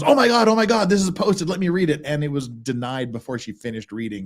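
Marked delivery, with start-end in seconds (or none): 0.0-2.0 excited gush. Retold or imitated. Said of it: "Megan goes".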